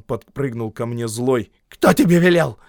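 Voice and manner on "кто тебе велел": angry. A narrator's reading. Gubin angry at boy.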